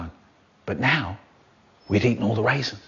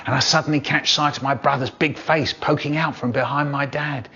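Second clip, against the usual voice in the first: ordinary delivery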